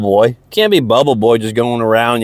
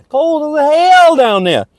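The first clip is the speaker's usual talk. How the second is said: high exaggerated; 14 semitones higher, slower than usual. Exaggerated comment on cold water.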